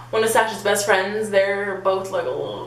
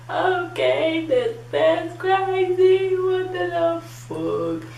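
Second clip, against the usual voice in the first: high, very slow drawn-out singsong; freaked-out singsong; 11 semitones higher, much slower than usual. Retold or imitated. Storyteller reacting to drunk guy, high and drawn out. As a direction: stretch every word out high, mock-aghast.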